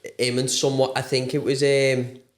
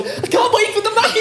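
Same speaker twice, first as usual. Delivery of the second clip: put-on kid voice; 3 dB louder than usual. Mocking kids' whiny request.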